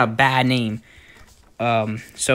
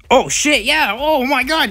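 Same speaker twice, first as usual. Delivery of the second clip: excited shouting; 12 semitones higher than usual. Real, not acted. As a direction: yell in sudden excitement. Charging at the tree.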